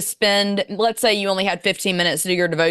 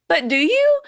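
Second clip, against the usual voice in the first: higher questioning voice, lightly acted; God; 9 semitones higher, 4 dB louder than usual. God questioning a know-it-all, lightly voiced pointed question. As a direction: pose it as a pointed, lilting challenge. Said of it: "God was kinda like".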